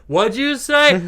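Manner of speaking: high, slow, puzzled ask; a stranger asking you to repeat yourself; mock puzzled girl. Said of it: "She's like".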